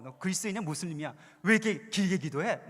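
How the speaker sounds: mocking tease. Friend teasing the praying coworker, mocking tone. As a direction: poke fun at his praying. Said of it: "막 이렇게 놀려서".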